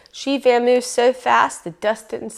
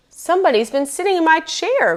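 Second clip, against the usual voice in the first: big grouchy bear voice, pitched high and pushed; 8 semitones higher than usual. A narrator's reading. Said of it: "in his great big grouchy voice".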